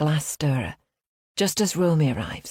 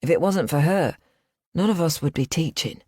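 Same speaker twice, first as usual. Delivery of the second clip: ordinary delivery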